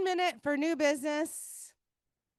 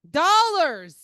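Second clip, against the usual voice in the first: emphatic correction, louder; 2 semitones higher, 9 dB louder than usual. Real, not acted. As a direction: call out the word firmly.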